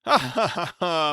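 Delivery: loud booming ha ha; hearty laugh. Acted. Jovial cousin laughing loudly at breakfast. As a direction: laugh big like a jovial bore.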